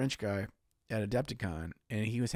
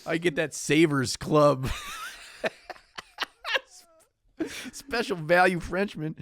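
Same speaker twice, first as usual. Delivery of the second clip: laughing; 9 semitones higher, 3 dB louder than usual. Real, not acted. Teasing Alex on the podcast, laughing. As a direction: laugh mid-phrase, then push on.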